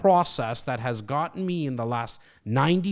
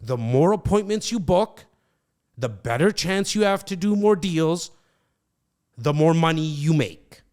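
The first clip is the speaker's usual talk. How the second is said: louder, each clause punched for emphasis; emphatic repeat; 2 semitones higher, 4 dB louder than usual. Shown as a demonstration. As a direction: drill the point like a coach. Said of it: "Let me just repeat that for you".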